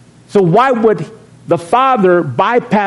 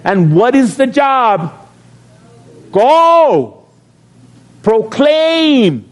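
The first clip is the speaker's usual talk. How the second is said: higher, slow, rousing preacher cadence; preaching; 6 semitones higher, slower than usual. Acted. Pastor urging his church family to go, preaching. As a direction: drive each command home to the church.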